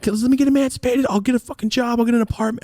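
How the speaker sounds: put-on high, pleading teen voice. Son begging his dad to let him leave, high and pleading. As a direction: beg in a high voice.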